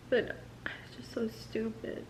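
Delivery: a bitter whispered aside. Real, not acted. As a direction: breathe it out, no voice.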